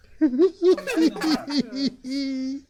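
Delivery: put-on high, eerie giggle of a child ghost; little girl laugh. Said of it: "my little girl's voice".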